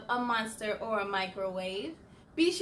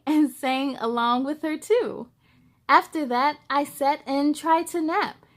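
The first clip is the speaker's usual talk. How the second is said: lively, louder storybook reading voice; story narration; 8 dB louder than usual. A narrator's reading. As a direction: read it like bedtime storytime for kids. Reads picture book aloud.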